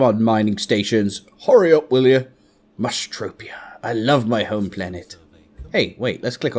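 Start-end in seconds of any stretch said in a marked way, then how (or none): 2.8-3.8 a soft loving whisper. Real, not acted. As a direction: breathe the name softly. Whispers home planet name fondly.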